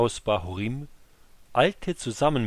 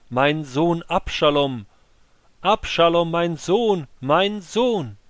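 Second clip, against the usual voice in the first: higher, louder anguished cry, slow at first; 7 semitones higher, 7 dB louder, slower than usual. Acted. Veiled king screaming his son's name again and again. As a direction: call the name out over and over in grief. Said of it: "schrie immer wieder".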